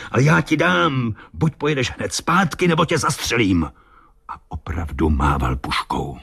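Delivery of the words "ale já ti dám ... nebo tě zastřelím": high-pitched threatening captain, volume held back; angry shouting. A narrator's reading. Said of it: "křičel kapitán". (výhrůžný křik kapitána)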